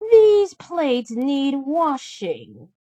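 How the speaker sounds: high, slow whiny stepsister; a spoiled stepsister piling on chores; high and drawn-out bratty sister. Acted. Stepsister voiced.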